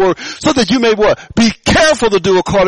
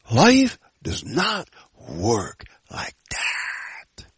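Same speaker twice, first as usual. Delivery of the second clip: slow down and whisper it for weight; hushed, slowed sermon whisper; dramatic whisper; 5 semitones lower, 8 dB quieter, much slower than usual. Acted. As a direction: drop to a breathy hush on the last words. Preacher drops voice for emphasis.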